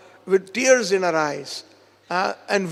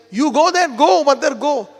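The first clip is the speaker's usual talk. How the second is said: louder, high, insistent go; urgent urging; 5 semitones higher, 6 dB louder than usual. Retold or imitated. Vishaka sends the mother off for help, urgently. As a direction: hurry her away, voice raised. Friend urges mother to hurry off.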